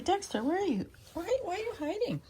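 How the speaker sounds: high, coaxing call to a cat; calling to pet. Real, not acted.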